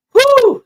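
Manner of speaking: high, loud blow of relief; relieved whew. Real, not acted. Fan exhaling relief after a dicey finish, high and loud. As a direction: let the tension out in one whew.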